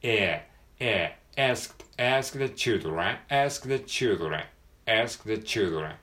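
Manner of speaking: "ask" with a vowel between a and e, slow; a-e middle vowel. Shown as a demonstration. Teacher models the a-e middle vowel in "ask the children", slowly. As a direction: open for A, say ä in "ask". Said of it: "Aの口の形をしながら".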